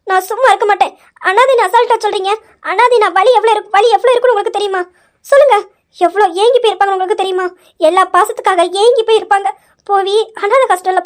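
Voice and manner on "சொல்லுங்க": sharp loud high demand; furious demand to the aunt; angry demand. Acted. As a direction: snap it out high and hard, demanding an answer. Angry confrontation, demanding answer.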